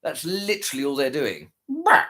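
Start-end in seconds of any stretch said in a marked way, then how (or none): 1.7-2.0 high, mocking bleat of a bah; silly bah. Mocking the trolls after a stream, high silly bah. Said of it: "in a silly voice".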